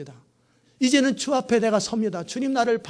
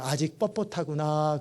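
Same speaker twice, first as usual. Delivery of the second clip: gentle rebuke; 6 semitones lower, 8 dB quieter, slower than usual. Retold or imitated. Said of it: "그러는 거예요".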